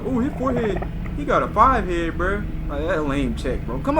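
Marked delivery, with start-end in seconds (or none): 0.0-2.4 mocking commenters. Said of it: "They're like".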